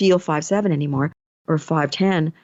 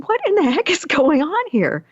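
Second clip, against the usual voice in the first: higher-pitched puzzled disbelief, retold; baffled; 9 semitones higher than usual. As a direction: wonder in a raised pitch what's happening. Baffled thought about her car.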